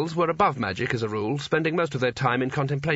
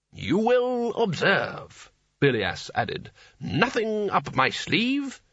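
much higher, very slow showman's patter; stage conjurer patter; 8 semitones higher, much slower than usual. Acted. Old wizard showing off to the boy, conjurer patter. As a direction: ham it up like a stage magician.

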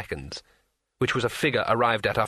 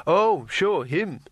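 raised, offhand and vague; 6 semitones higher, 3 dB louder, much slower than usual. A narrator's reading. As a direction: brush it off vaguely. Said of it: "said Rincewind vaguely".

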